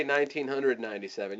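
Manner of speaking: "nineteen hundred ninety-seven" run together quickly; quick casual price. Shown as a demonstration. Coach showing the wrong way to quote price, quick and casual. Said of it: "you don't wanna say".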